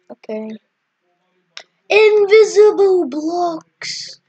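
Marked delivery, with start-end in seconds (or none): 1.9-4.2 high, loud and slow; excited. Real, not acted. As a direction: announce it with delight. Thrilled by a trick.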